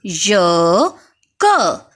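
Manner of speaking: ordinary delivery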